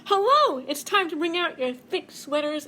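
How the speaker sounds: very high, loud hello; cold air announcing itself; very high, loud greeting; chilly voice. Acted.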